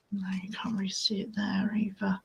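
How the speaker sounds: breathy under-breath whisper; whispered aside. Real, not acted. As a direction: mutter it to yourself in frustration.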